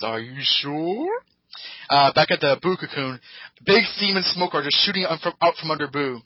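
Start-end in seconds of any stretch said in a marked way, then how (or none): 0.0-1.1 doubtful Goku. Retold or imitated. Goku questions Vegeta.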